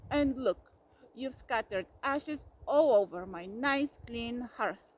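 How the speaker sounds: loud, building bellow; roared. A narrator's reading. Bear refusing all birthday things, loud roar. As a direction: roar each refusal harder.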